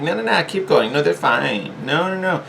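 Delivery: high, dismissive voice of self-doubt; nagging inner naysayer; inner saboteur voice. Acted. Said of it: "this is the voice that's saying".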